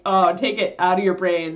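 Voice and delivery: ordinary delivery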